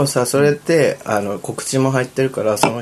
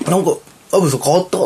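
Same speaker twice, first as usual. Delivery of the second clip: quoting a customer; 8 semitones higher, 4 dB louder than usual. Retold or imitated. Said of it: "って言って".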